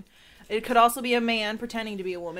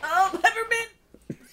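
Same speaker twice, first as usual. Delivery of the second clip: choking on candy; 12 semitones higher, slower than usual. Real, not acted.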